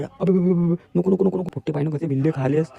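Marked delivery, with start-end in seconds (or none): 0.0-1.5 mock panic. Mock panic over poison.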